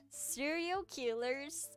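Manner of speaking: ordinary talk, nothing marked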